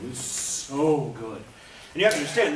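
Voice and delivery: normal talking voice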